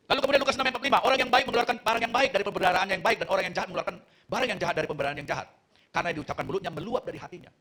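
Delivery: high-pitched, forceful Bible quoting; scripture recitation. Real, not acted. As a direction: proclaim the verse to the whole congregation. Recites a Bible verse to congregation.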